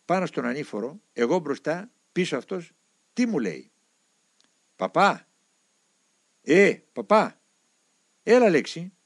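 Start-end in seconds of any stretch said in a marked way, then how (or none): 4.8-8.9 loud call to someone ahead. A narrator's reading. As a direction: call the priest from behind, louder. Calling to the monk walking ahead. (δυνατό κάλεσμα από πίσω)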